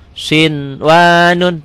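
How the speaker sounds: very slow "Sinwanun", N sounded clearly; N without holding. Shown as a demonstration. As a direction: draw out "Sinwanun" but not its first N. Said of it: "tanpa ditahan".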